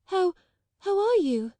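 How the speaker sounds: put-on high, halting girl voice; stammering shyly. A narrator's reading. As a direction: stammer it out, shy and awkward. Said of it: "she stammered".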